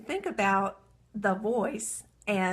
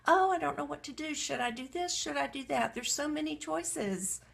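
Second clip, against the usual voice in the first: higher-pitched, dithering self-talk; mock confusion; 6 semitones higher than usual. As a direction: act out being overwhelmed by options. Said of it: "Stay confused".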